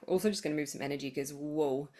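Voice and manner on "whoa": usual speaking voice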